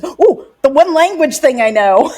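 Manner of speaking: high eager quote; mock excited. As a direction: play it eager and pleased. Retold own excitement.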